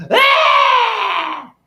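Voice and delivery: long, very high mock scream; imitated crowd scream. Host showing how everyone reacts, mock screaming. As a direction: let out a sustained high-pitched mock shriek. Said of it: "And everyone does this".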